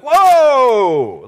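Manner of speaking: long high loud whoa, falling away; jolted by a sudden kick; startled whoa. Retold or imitated. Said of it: "she's like".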